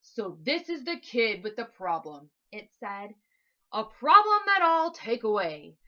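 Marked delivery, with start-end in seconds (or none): 0.0-2.3 low, rumbling creature voice; giant monster voice. Acted. Monster sizing up the frightened boy, low and big. 3.7-5.8 very loud, big monster boom; booming giant monster. Acted. Monster promising to solve the problem, booming loud.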